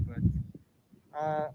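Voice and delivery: muffled behind a face mask; muffled through mask. Real, not acted. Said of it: "so my voice is all muffled".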